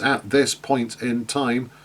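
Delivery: slow, deliberate quoting of "At this point in time."; slow example phrase. Shown as a demonstration. Teacher slowly quotes the cliche for the class, deliberate. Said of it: "classic example I always use here".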